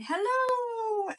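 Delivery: high, loud call across a mountain; shouted hello. Acted. Child on a mountaintop shouts out loud. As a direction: call out to the far peak. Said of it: "I shouted".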